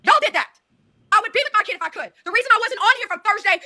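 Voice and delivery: high, heated and hurt; upset outburst. Real, not acted. Accused mother answers her live critics, hurt and heated.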